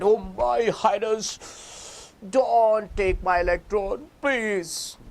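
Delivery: high, slow pleading to the ruler; scared carbon. Acted. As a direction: beg the oxygen king timidly, drawn out. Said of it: "Carbon is scared".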